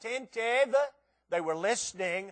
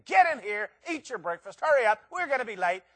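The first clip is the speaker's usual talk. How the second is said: louder hurried mom; mock mom yelling; 3 semitones higher, 6 dB louder than usual. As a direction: hurry the kids along. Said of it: "Mom's yelling".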